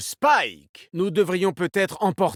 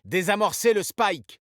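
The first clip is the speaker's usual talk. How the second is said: higher, pressing order; urgent order; 5 semitones higher than usual. Acted. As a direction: give the order under pressure. (ordre pressant, voix plus aiguë)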